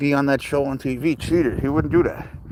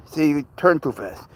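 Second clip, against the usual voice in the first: normal talking voice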